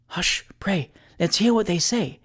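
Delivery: hushed plea, starting in a whisper; eager, hushed urging; urgent exclamation. A narrator's reading. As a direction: whisper for silence, then lift the pitch. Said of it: "exclaimed Missus Tibbs".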